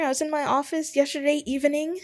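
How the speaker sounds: ordinary delivery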